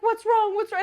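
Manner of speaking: loud, high worried dad; alarmed concern. Retold or imitated. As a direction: ask urgently, imitating a panicked parent. Dad alarmed by crying daughter.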